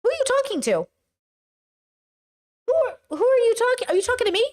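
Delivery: high-pitched, incredulous challenge; exaggerated high "who, me?" indignation; mock affronted. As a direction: act out being offended at being addressed. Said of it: "all of us are gonna be like".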